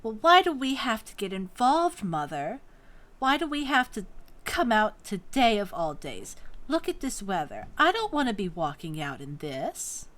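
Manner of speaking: put-on loud complaining young woman; whiny daughter. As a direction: whine at mother, exasperated. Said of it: "the shortest of the three then looks up at the tallest and says".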